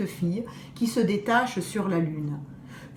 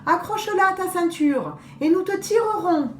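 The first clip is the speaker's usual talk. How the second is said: put-on girl's sweet offer; 8 semitones higher than usual. Girl speaks to the wolf.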